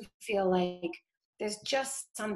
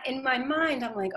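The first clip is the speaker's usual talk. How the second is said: normal talking voice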